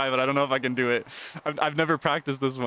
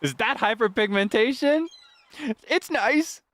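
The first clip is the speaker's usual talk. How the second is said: climbing mock amazement; 12 semitones higher, 3 dB louder, slower than usual. Player joking about what they see, climbing to a high exaggerated cry.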